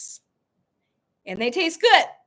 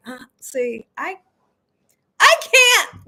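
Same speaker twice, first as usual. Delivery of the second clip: mock refusal; 4 semitones higher, 6 dB louder than usual. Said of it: "But I'm like".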